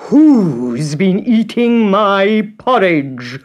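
slow, loud, big bear roar; lightly roared papa bear; Father Bear roaring. A narrator's reading. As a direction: boom it out like a huge papa bear. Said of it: "roared Father Bear".

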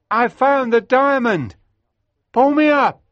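high, loud urgent call; excited shout. A narrator's reading. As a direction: yell to be pulled up. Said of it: "I shouted".

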